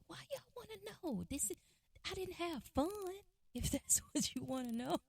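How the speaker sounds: very high, whiny tearful voice; imitated sobbing. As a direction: squeak it out like an upset boy, imitated. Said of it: "he just kinda sobbed and cried".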